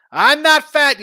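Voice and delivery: blurted out high and loud; loud high protest. Real, not acted. Host blurts a loud high protest to chat. Odd outburst mid betting talk.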